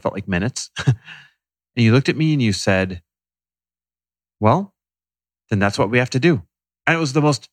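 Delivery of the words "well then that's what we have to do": emotionless, resigned acceptance; quoting a wife's unemotional reply; matter-of-fact. Retold or imitated. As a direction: state it simply after a pause. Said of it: "emotionless, matter-of-fact statement ever".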